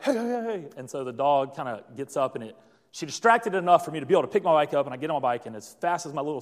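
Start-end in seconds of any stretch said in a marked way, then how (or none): 0.0-0.7 high-pitched calls at a dog, not full volume; shooing call, acted lightly; mock yell. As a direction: imitate a woman yelling to distract a dog. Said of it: "yelled at the dog".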